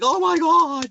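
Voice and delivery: imitating loud drummers; mock shriek. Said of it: "some people are like".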